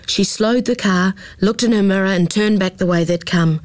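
plain reading voice

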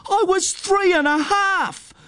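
very high, drawn-out wail of protest; outraged squeal, acted; indignant protest. A narrator's reading. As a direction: protest at the top of your pitch range, stretching it out. Acted quarrel, indignant shouted comeback.

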